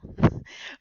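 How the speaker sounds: quick embarrassed laugh; laughing at own accent. Real, not acted. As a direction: burst of loud breathy laughter. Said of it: "mon accent".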